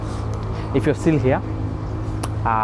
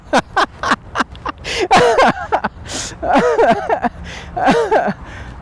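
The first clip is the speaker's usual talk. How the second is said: high laugh. Real, not acted. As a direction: burst out laughing. Laughs at his own joke.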